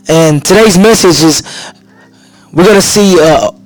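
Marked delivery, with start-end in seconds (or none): none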